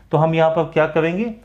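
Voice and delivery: ordinary delivery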